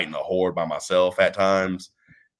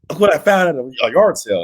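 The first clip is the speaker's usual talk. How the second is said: mildly excited retelling, louder; 6 semitones higher, 5 dB louder than usual. Retold or imitated. Friend shows off his yard-sale trivia book, excited. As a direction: imitate a friend eager to share a find. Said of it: "He was so excited".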